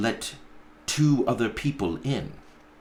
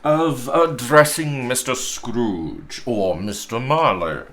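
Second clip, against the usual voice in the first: gentleman's voice; 4 semitones higher, 5 dB louder than usual. Acted. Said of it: "said one of the gentlemen".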